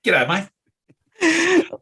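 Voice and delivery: exaggerated Australian twang; Australian accent. Shown as a demonstration. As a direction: greet like a broad Australian. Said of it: "That's how you really speak Australian".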